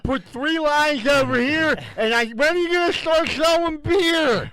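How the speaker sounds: high, loud quoted griping; loud mock complaint. Someone at the store griping about waiting for beer, loud mock complaint. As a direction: belt it out high, as an exaggerated complaint.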